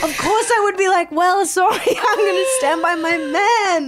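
high voice breaking into laughter; tearful declaration. Real, not acted. Overwhelmed, laugh-crying declaration of loyalty.

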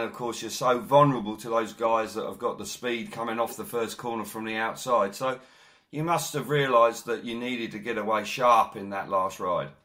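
usual speaking voice

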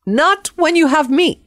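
put-on high, emphatic Spanish-accented protest; insistent cleaning lady. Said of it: "my cleaning lady jumped up and said".

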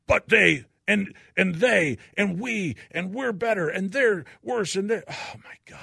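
high-pitched, exasperated mimicry of squabbling; mock bickering. Mimics us-versus-them bickering, exasperated.

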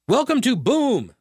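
high announcer-like swoop on Boom; stress on BOOM. Shown as a demonstration. Host reading the group's website welcome, Boom stressed. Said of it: "you gotta say it like that".

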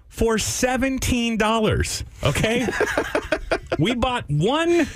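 high, slow and disbelieving; incredulous. Real, not acted. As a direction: rise in disbelief at the price. Outraged at seventeen dollar charge.

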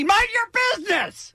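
put-on very high old-man scream; shrieked mock outburst. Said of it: "And he said again".

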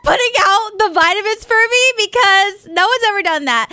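high, loud, gushing thanks; overwhelmed gratitude. Retold or imitated. Girlfriend thanking boyfriend for small kindnesses, overwhelmed. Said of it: "that's probably really fucking sad".

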